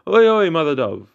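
storyteller's high, loud call; shouting. A narrator's reading. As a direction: holler it up high without a full scream. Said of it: "shouted as loud as he could".

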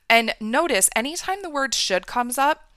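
usual speaking voice

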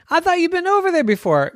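put-on high, fast child; accusing Jenny. Jenny questioning her brothers, high and fast. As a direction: catch them out.

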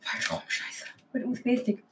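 very soft whisper; ASMR whisper. Shown as a demonstration. Podcaster moving on to the ASMR part, whispering. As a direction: whisper it close, barely audible. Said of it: "wir fangen an mit A S M R".

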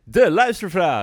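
slow, high, loud segment call; announcer voice. Acted. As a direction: boom it out like a jingle.

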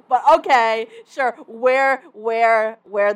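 loud mock agreement, hammering the repeats; sarcastic concession. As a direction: concede loudly with heavy sarcasm. Sarcastic concession mocking misogynists' argument.

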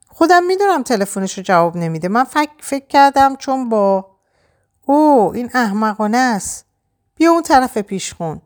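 lightly voiced Greg, losing his words; flustered Greg. A narrator's reading. (گرگ کلافه و دستپاچه، با صدای زیرتر)

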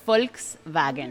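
slow, careful Volkswagen, said FOLKS-vah-gen; German pronunciation. Shown as a demonstration. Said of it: "Very good".

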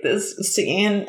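usual speaking voice

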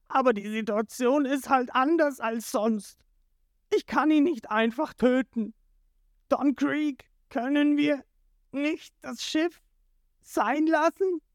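high, whiny begging voice; desperate pleading. A narrator's reading. Jin begging his captain for mercy, high plea. Jin begs Krieg to relent.

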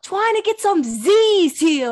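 "Trying to get some Z's here" high, slow, with R's turned to W's; lisping kid yell. Retold or imitated. Mother imitates son wanting "Z's here", loud childish lisp. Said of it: "the way he just lisps everything".

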